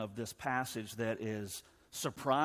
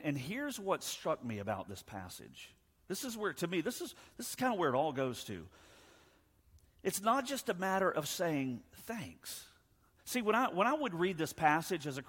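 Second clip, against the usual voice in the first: ordinary delivery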